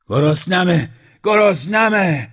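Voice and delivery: loud, high-pitched hungry bellow; roaring yell. A narrator's reading. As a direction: roar it out, stretching the last cry. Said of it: "نعره کشید".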